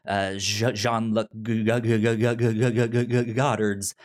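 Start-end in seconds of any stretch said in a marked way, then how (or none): none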